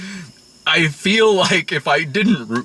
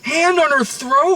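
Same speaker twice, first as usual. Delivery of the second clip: normal talking voice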